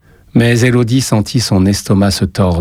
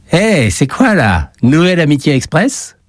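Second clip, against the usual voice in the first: mocking teen bully; 8 semitones higher than usual. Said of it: "une voix retentit".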